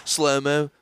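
ordinary delivery

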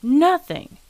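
high, loud exclamation; outraged cry. A narrator's reading. Father echoes his son in disbelief, loud outcry. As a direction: throw the word back loudly. Father cries back son's answer.

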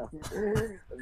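high breathy giggle; real laugh slipping out. Real, not acted. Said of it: "Chase, stop making me laugh".

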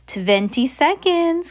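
high, drawn-out countdown cry. A narrator's reading. Grand High Witch counting down to her audience, high slow cry. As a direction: stretch the call out slowly, pitched high.

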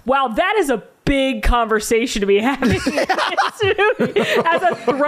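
laughing through the words; amused, laughing disbelief; chuckling at a movie scene. Real, not acted. Laughing at the line.

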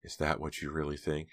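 soft, stunned question; hurt and quiet. A narrator's reading. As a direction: ask quietly, wounded. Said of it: "face had gotten very pale".

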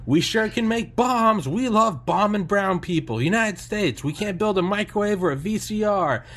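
high, loud sarcastic rant; ranting comic. Retold or imitated. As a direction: rail at the audience like a stand-up comic. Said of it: "It's like".

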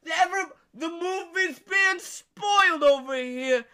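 slow, high, loud wail; mock public warning. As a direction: raise the alarm as the wronged moviegoer. Stall man warning everyone.

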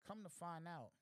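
usual speaking voice

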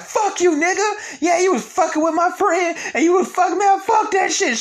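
shrill-high mock screeching girlfriend, loud; exaggerated high falsetto tirade; mock Megan. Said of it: "She's like".

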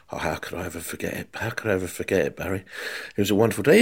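soft, low reminiscing voice; gentle quiet Bobby Moore. Retold or imitated. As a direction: hush it like a kindly old pro remembering. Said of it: "Bobby Moore answers".

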